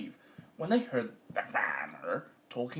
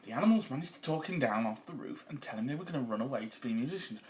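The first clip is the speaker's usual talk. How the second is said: ordinary delivery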